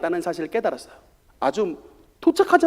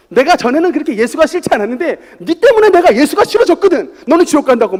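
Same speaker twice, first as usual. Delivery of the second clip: hurling a curse, louder and higher; mock curse; 6 semitones higher, 8 dB louder than usual. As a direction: spit it out like a curse. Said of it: "막 저주를 퍼부어요". (저주를 퍼붓는 흉내)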